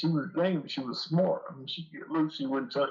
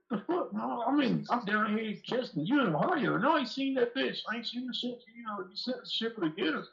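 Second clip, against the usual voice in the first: incredulous retort; 8 semitones higher than usual. Retold or imitated. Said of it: "I'm like".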